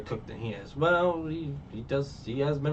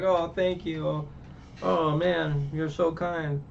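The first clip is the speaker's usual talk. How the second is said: grateful thanks; 6 semitones higher, slower than usual. Retold or imitated. Grateful pirate thanks Sanji.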